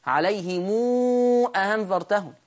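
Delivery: عليهم sonunda uzatılmış "mû"; sıla ile okuma. Shown as a demonstration. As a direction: say عليهم as "aleyhimûû", lengthened before أنذرتهم. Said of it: "أصل هعمل لها صلة".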